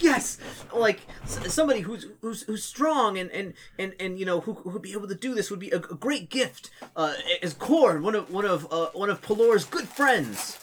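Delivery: a hint of a nervous young acolyte; earnest acolyte. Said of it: "they're like".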